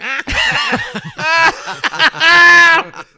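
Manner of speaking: loud, high cackle; long hammed-up cackle carrying from the back of the room; Hughesy's laugh. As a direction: imitate a helpless, piercing laugh. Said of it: "All I could hear was Hughesy laughing".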